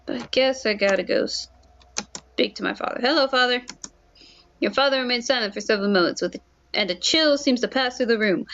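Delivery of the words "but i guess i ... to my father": ordinary talk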